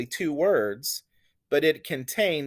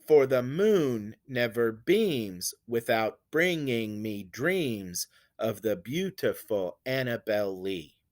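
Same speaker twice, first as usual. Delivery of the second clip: slow da-da-DUM, peaking on "moon", "beams", "dreams"; two light syllables, then lean on "moon" and "Lee"; anapestic beat; 3 semitones lower, slower than usual. Shown as a demonstration. Teacher showing how an anapest will sound, anapestic beat. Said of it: "let's see how an anapest will sound".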